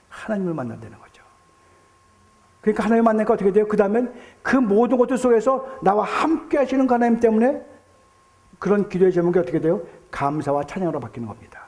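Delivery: normal talking voice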